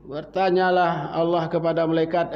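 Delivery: ordinary talk, nothing marked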